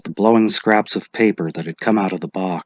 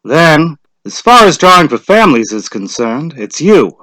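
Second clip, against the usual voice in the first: lightly voiced official, raised and brisk; a hint of a village official calling out; lightly voiced lottery official; Mr. Summers' voice; 6 semitones higher, 7 dB louder than usual. A narrator's reading.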